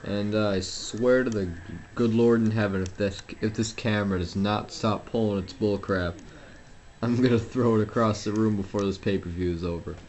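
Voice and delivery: ordinary talk, nothing marked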